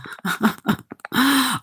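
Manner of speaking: a short breathy laugh at the chat; brief scoffing laugh; amused laugh. Real, not acted.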